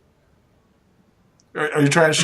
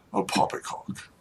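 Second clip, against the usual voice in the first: softer lordly dismissal; scoffing duke; 9 dB quieter than usual. Acted. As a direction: dismiss it under your breath like a duke. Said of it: "He's like".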